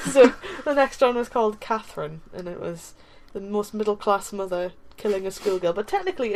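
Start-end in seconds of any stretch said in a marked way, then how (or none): none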